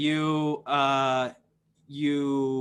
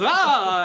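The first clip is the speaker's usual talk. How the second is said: high, loud frightened yelp; guard startled by a sudden roar; 8 semitones higher, 8 dB louder than usual. Acted. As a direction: jump back with a yelp. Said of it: "And he goes".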